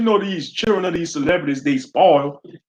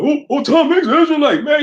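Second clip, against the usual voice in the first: mocking street preachers; 13 semitones higher, 4 dB louder than usual. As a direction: caricature them, high and eager. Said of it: "You go".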